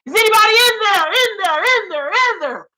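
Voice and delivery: high, loud calling into an empty void; mock echoing call. Mocking [name] with a crude joke, high echoing call. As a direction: yell hello into a cavern as if lost. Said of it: "That bitch is like".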